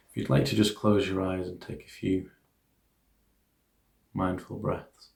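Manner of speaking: soft, hushed meditation guidance; guided meditation. Acted. Teacher guiding listeners through an equanimity meditation, soft hushed guidance.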